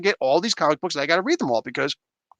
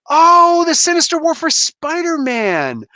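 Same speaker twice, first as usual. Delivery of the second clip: loud, high, drawn-out "oh" of recognition; sudden realization; 9 semitones higher, 4 dB louder, slower than usual. Real, not acted. Streamer finally gets what viewers are asking, loud realization. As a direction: exclaim it high as it suddenly clicks.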